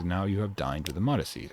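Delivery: ordinary talk, nothing marked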